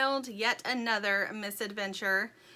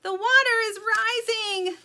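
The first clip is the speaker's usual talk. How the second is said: high, loud cry of alarm; alarmed yell; 13 semitones higher, 9 dB louder than usual. A narrator's reading. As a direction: yell a warning as the flood climbs. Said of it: "yelled Poppy".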